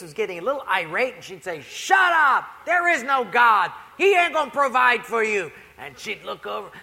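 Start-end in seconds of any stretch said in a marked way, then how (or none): 1.6-5.5 loud, high furious shout; angry neighbor yelling. Retold or imitated. Atheist neighbor yelling at the praying lady, furious and loud. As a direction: scream it over the fence.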